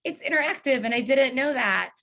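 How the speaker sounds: high startled "Ah" fading to plain; lightly voiced surprised attendee; mock surprise. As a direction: play a caught-off-guard attendee. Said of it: "some folks were like".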